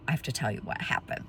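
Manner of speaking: very low, hushed husband voice; grave confession. Retold or imitated. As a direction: imitate him breaking it gently, under the breath. Husband about to confess, retold.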